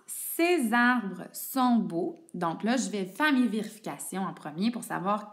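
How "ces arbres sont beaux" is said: slow example read. Shown as a demonstration. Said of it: "dans ma deuxième phrase".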